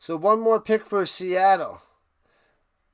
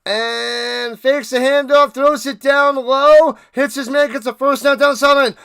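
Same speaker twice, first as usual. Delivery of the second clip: rising, louder excited game call; live play-by-play; 6 semitones higher, 7 dB louder than usual. Acted. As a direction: build the call as the play unfolds.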